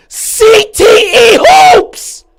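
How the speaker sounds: drawn-out, high scream; furious scream. Real, not acted. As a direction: stretch it out at full blast. Screamed catchphrase, then said low.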